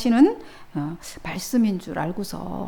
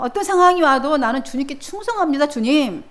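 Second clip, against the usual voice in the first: higher, earnest vow of loyalty; 맹세하는 베드로; 4 semitones higher, 3 dB louder than usual. Acted. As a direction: swear it proudly to the master. 베드로의 맹세 연기. (높은 목소리로 충성을 맹세하듯)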